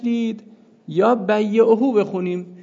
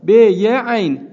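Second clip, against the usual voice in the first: spelling out letters; 7 dB louder, much slower than usual. Shown as a demonstration. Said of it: "سه حرفی".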